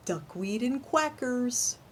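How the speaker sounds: slow, playful punchline; joke punchline. Acted. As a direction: land the pun slowly.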